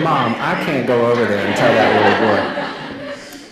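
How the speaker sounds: put-on laughing through a child's protest, slow; half-laughing as the boy balks. Boy laughing to his mom, balking at the order.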